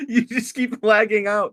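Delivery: high-pitched laughing; laughing while speaking slowly. Real, not acted. Laughing at lag.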